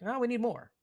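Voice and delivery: high, bright mock of Russ's answer. Mocking Russ's answer to the reporter in a high voice. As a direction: squeak it out teasing Russ.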